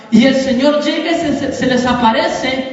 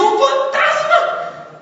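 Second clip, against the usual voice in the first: very high, slow comic wail; mock frightened scream; much slower than usual. Preacher imagining Crescencio crying out at a ghost, mock scream. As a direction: stretch it out in hammed-up terror. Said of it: "se imagina Pedro gritando".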